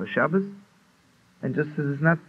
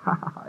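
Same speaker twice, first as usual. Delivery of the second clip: quiet huffed ha ha ha; a hushed little chuckle; soft mock laugh; 3 dB quieter than usual. [name] cracks up over the groom's dowry, quiet mock laugh. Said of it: "I start laughing".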